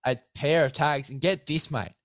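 upbeat sponsor pitch to a mate; hyped ad read. Acted. Host pitching sponsor gear to guest, hyped ad read. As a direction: sell the gear like a mate on air. Sponsor code plug continues.

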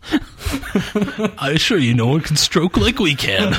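very slow, high grand ham voice; drawn-out theatrical overacting; hammy stage actor. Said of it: "didn't overact as much as Daniel Day".

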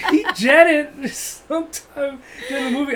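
high, loud, slowed by laughter; real laughing. Real, not acted. Comedian tells how his buddy fled the movie, laughing. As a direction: laugh your way through the words. Laughs telling friend's disappearance.

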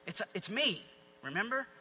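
understated, quiet reassurance; gentle reassurance. Acted. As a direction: drop the volume and remind them gently. Jesus reassuring the screaming disciples.

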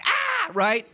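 high-pitched comic yelp of alarm; mock frightened cry. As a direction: yelp as if caught in nervous dread. Comic nervous reaction to Jesus' story.

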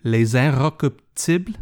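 slow, careful attempt at French pronunciation; attempted French accent. Shown as a demonstration. Host copying French wife's pronunciation, careful French accent. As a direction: sound out the title in French, slowly. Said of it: "I asked her how it's pronounced".

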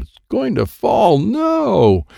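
high, rising storybook alarm; alarmed cry. A narrator's reading. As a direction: cry out in alarm, stretching the last word. Narrator cries out as tree falls.

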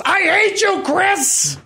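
high-pitched mock-angry yell; retold scream. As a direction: yell it as if from another room. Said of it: "I screamed from my office".

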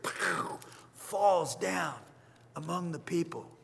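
soft, half whispered; hushed awe. Real, not acted. Preacher describing the Spirit falling, hushed. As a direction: hush it as if sacred.

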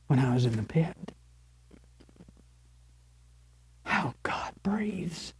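hushed, breathy whisper of awe; whispered reverence. Acted. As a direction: drop to a whisper as if sharing a secret.

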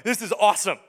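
loud, exhilarated burst; wow, this is amazing; thrilled Peter. Acted. Peter standing on the water, thrilled. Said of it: "Peter's thinking this, like".

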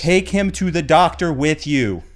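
slow, loud, high-pitched order; hammed-up parent bellowing at a partner; exasperated mock yell. Parent sending partner off with kid, exasperated yell.

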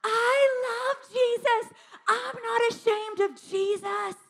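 high, slow, sung-out cry of faith; high drawn-out declaration; mock scream. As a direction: belt it out like a ninth grader leaping up. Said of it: "she screams at the top of her lungs".

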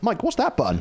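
high-pitched pretend curiosity; mock innocent question. Host asks co-host about the cut button, high mock innocence. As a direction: ask lightly and high, playing innocent.

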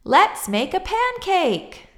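put-on high, slow, loud child's call; excited shout. A narrator's reading. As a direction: call out proudly with the egg. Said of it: "shouted Jack".

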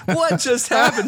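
usual speaking voice

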